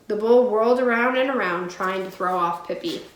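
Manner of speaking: ordinary delivery, nothing marked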